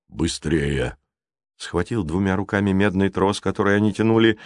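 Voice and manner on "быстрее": low, drawn-out giant voice; a low, contained word of urgency; giant's urging. A narrator's reading. Giant urges children to hurry, low and weighty. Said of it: "потом сказал только одно".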